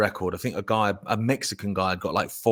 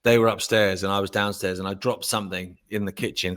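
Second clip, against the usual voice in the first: usual speaking voice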